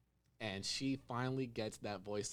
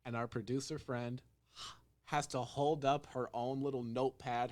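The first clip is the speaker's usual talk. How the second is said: ordinary delivery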